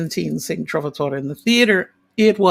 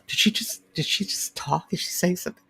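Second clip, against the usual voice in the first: hushed whisper, mostly breath; backstage whisper; 9 dB quieter than usual. Retold or imitated. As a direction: whisper so the stage won't hear. Whispering backstage during performance.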